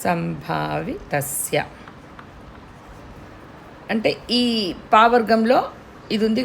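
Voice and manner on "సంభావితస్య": సంభావితస్య slow and soft, the ం as a closed మ్; m sound in sambhavitasya. Shown as a demonstration. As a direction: ease out సంభావితస్య, lips shut on మ్. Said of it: "ఇక్కడ ఎం sound వస్తుంది". (సంభావితస్య ను మ్ తో మెల్లగా పలకండి)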